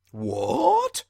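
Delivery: high, loud startled cry; sharp rise of alarm. Acted. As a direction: jolt awake with a shocked question. Sleeper startled awake by noise.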